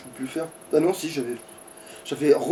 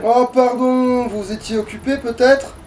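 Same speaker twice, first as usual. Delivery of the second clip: mock politeness; 8 semitones higher, 4 dB louder, slower than usual. Mocking the boss in game.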